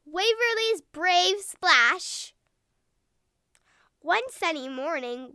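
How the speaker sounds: ordinary delivery, nothing marked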